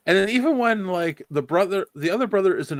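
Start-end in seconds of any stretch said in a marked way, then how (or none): none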